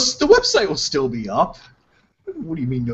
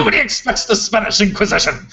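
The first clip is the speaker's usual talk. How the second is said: Monty Python quote; 4 semitones higher than usual. Retold or imitated. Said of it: "the Spanish slash French espionage that you do".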